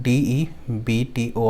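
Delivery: DEBTORS spelt out letter by letter as डीईबीटीओआरएस; spelling out. Shown as a demonstration. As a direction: say each letter of डीईबीटीओआरएस separately. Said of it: "इसकी spelling मैं pronounce कर रहा हूँ". (डीईबीटीओआरएस का एक-एक अक्षर अलग बोलते हुए)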